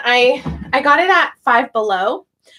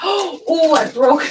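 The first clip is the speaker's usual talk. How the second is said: ordinary delivery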